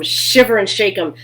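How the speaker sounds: ordinary delivery, nothing marked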